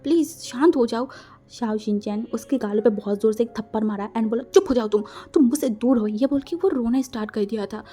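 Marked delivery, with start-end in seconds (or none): 0.0-1.1 high, loud, rushed apology; pleading apology. Acted. Lover begs his angry partner to forgive him, high and pleading. 4.4-6.1 angry order. Acted. Hurt partner orders his lover away, loud and angry. After slap, orders silence.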